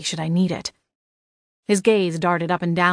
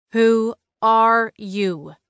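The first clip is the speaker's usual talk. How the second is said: very slow, word-by-word angry demand; spat through gritted teeth; 4 semitones higher, 3 dB louder, much slower than usual. A narrator's reading. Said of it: "I spat between gritted teeth".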